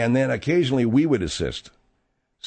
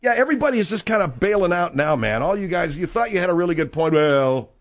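mocking; 6 semitones higher than usual. Mocking guests backing down.

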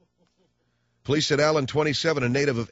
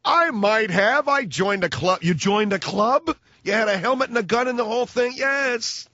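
put-on silly high old man; 8 semitones higher than usual. Acted old Nazi answers.

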